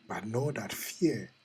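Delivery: ordinary talk, nothing marked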